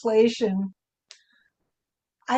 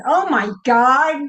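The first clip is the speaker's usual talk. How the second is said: higher, louder stunned "Oh my God"; stunned exclamation; 5 semitones higher, 7 dB louder than usual. Shown as a demonstration. Showing the group how she says "Oh my God" when stunned. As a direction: blurt out "Oh my God" in shock. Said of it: "when I'm absolutely stunned".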